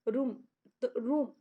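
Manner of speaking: रूम said with a long held oo, set against बुक. Shown as a demonstration. Teacher contrasts long रूम with short बुक for students. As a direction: stretch the ऊ in रूम for the class. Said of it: "ऊ के ऊपर हम जोर दे रहे हैं ना रूम".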